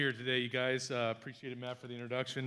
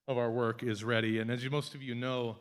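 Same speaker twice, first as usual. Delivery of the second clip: normal talking voice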